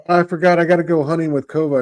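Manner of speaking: hurried excuse. Acted. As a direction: blurt out a quick mock excuse. Voices character making hasty excuse.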